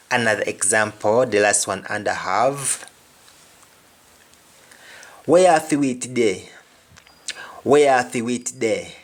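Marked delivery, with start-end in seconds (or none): 5.2-8.9 patois Where are we today; Jamaican patois. Shown as a demonstration. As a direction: ask in patois. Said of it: "Meaning, what do you have".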